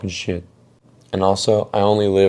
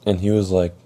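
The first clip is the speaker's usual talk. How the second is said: ordinary talk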